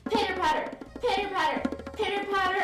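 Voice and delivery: usual speaking voice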